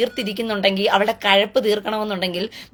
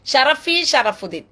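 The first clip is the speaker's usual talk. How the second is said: mocking name call; 3 semitones higher, slower than usual.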